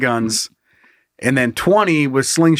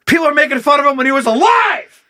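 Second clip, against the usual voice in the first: loud, high protest; shouting; 11 semitones higher, 4 dB louder than usual. Real, not acted. Laughing protest.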